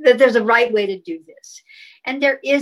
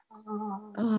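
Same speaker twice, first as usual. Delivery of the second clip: a soft held ah, a step higher; 12 dB quieter than usual. Shown as a demonstration. Guest raises the heart tone, a soft higher ah. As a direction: float the ah up gently and quietly. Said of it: "a little higher".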